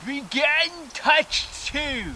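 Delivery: high, slow referee call; announcer bird. Retold or imitated. The bird announces the second round.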